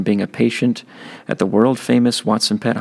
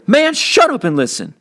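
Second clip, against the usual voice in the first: irritated brother; 5 dB louder than usual. Acted. Byron cuts off his brother.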